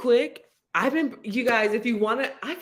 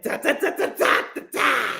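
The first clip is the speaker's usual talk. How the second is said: mock Russian gibberish; 5 semitones higher than usual. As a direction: fake Russian babble, raised pitch. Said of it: "I'm like".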